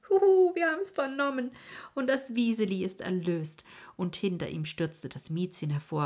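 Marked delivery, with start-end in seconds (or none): 0.1-3.6 put-on high, loud boyish whoop; exuberant shout. A narrator's reading. Otto bursts into the room, whooping the news.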